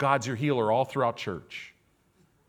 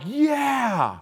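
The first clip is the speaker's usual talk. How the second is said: cheering; 11 semitones higher, 4 dB louder than usual. Retold or imitated. People celebrating after the sea.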